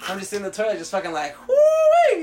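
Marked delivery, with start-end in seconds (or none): 1.5-2.2 loud, very high woo-wee whoop. Acted. Man cooling off naked in the stall, whooping loudly. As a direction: let out a piercing holler of delight.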